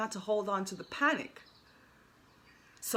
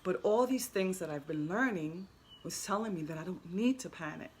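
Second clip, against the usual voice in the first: usual speaking voice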